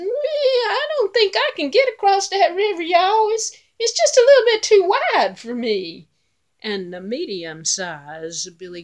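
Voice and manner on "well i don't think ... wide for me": high, loud little goat voice. Acted. Little goat telling his brothers the river is too wide, high and anxious. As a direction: squeak it out like a tiny worried goat.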